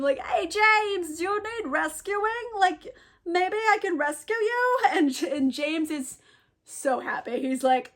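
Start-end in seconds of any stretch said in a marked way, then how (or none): 0.2-4.8 calling out. Retold or imitated. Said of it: "he's calling out to him".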